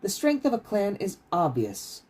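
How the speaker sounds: stern teacher. Acted. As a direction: pace and pronounce it with cold authority.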